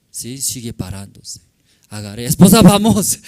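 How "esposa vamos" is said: shout. Retold or imitated. Husband yells at lingering wife.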